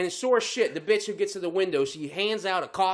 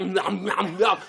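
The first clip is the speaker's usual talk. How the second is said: imitated gummy chomping noises; toothless gumming; much slower than usual. Imitating the woman with no teeth, mock gumming. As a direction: smack out toothless munching syllables. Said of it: "doing the gum thing".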